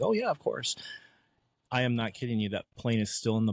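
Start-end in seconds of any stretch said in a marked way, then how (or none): 0.0-0.8 mock eager. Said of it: "And I was like".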